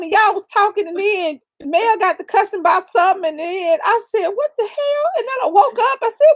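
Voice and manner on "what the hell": high-pitched startled question; acted bewilderment. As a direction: react as if jolted awake, voice way up. Woken by cussing, retold.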